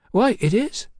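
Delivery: high-voiced cheeky London girl. A narrator's reading. Hetty agrees.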